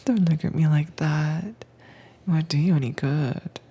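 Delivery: ordinary delivery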